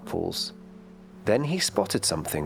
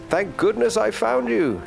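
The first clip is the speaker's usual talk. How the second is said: very high, louder grandmother voice; relieved Grandma; 6 dB louder, slower than usual. A narrator's reading. Grandma who searched the storm for Noi, relieved cry. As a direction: exclaim it high and loud, with relief.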